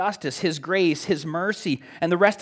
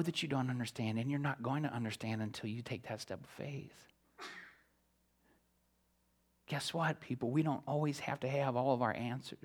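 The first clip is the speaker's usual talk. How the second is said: much softer, intimate earnest plea; hushed appeal; 3 semitones lower, 11 dB quieter than usual. Acted. Preacher quietly urging the congregation toward faith. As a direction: lean in and urge them gently.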